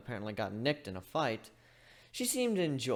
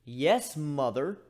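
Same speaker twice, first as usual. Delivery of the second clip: sarcastic; much slower than usual. Acted. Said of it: "I said sarcastically".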